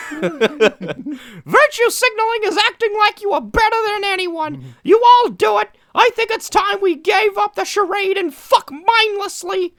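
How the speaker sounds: gleeful high falsetto announcement; Mickey Mouse. Pretend. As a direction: shout it like a giddy cartoon mouse. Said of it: "Mickey Mouse".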